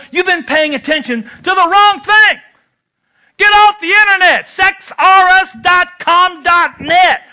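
high, loud, pounding exhortation; preaching at full force. Real, not acted. Preacher shouts at husbands to quit the internet, full-force preaching. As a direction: hammer it home to the congregation.

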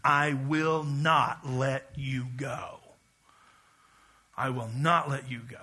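quiet, very slow vow of loyalty; slow earnest vow. Shown as a demonstration. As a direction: promise it slowly and firmly. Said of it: "the sound of loyalty".

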